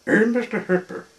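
quick, high and loud; character's exclamation. A narrator's reading. Mister Hooper addresses his lookalike, quick high character voice. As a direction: pipe up loudly in a storybook voice. Character questions in narrated dialogue.

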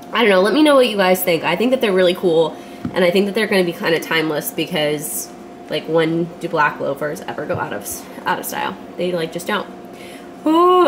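usual speaking voice

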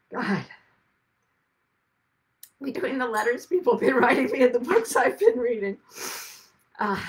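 real emotion spilling over mid-sentence; cracking up. Real, not acted. Thanking readers' letters, voice breaking with real tears. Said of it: "I did not think I would crack up like that".

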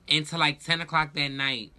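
usual speaking voice

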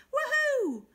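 loud, high celebration; joyful whoop. Acted. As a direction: whoop for joy. Hare celebrates fair sharing.

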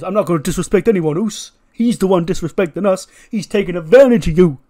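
loud, high, heated protest; Jey Uso. Acted. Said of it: "And Jey goes".

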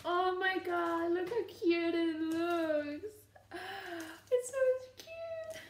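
very high, slow, near-tearful gushing; melting over something adorable; overwhelmed squeal. Real, not acted. Showing viewers the cute gift, squealing close to tears. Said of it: "I'm so excited".

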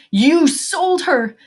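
high, drawn-out accusing scream; loud, slow, high-pitched cry of betrayal; anguished scream. A narrator's reading. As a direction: scream it from deep in the gut. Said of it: "from somewhere deep in his gut, screamed".

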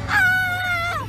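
loud high cry of protest; scream. Real, not acted. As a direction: scream out as if hurt. Groggy patient says she is hurt.

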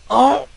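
loud, very high burst of frustration; angry outcry. Real, not acted. Gamer getting angry at failing, exasperated outcry. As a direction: cry out, pitch jumping right up. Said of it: "I'm getting angry now".

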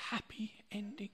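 the words fall to a soft whisper; tender whisper. Acted. Man proposing to his wife, ending in a whisper. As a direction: hush it like a secret wish.

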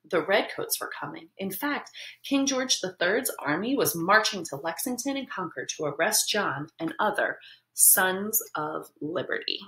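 ordinary delivery, nothing marked

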